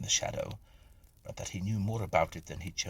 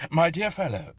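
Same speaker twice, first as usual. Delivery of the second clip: hearty host; 10 semitones higher, 8 dB louder than usual. A narrator's reading. Masterful host brushes off objections.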